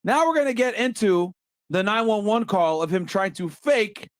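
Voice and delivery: normal talking voice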